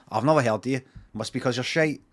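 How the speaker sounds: ordinary talk, nothing marked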